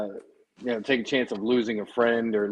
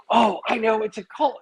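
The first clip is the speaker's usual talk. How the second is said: surprised; 11 semitones higher than usual. Retold or imitated. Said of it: "I was like".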